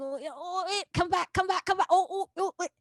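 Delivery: high, flustered mock alarm; mock panic. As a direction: play someone scrambling to get back in step. Acting out slipping out of sync.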